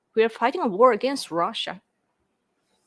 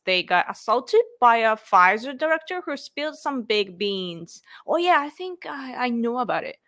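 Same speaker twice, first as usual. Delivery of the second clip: ordinary talk, nothing marked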